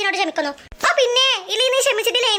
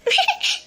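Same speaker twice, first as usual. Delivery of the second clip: short high giggle; a quick gleeful giggle to herself; 7 semitones higher than usual. Acted. About to reveal her prank idea, short high giggle. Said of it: "നീ എന്തിനാ ഇങ്ങനെ ചിരിക്കുന്നേ".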